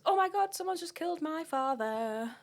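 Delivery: mock scream, pitched high then falling; retold scream.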